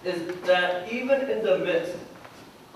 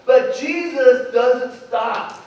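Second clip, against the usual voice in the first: ordinary talk, nothing marked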